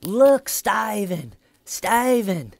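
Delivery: high, loud, slow at the end; hammering interrogator. Retold or imitated. Red Shirt scratching his own hair at the suspect, loud and high. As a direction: drag out each Look, Steven to rattle him. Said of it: "hair and going".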